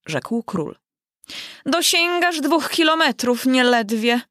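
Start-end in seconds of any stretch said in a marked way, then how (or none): 1.7-4.2 queen calling out. Acted. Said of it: "wołała królowa".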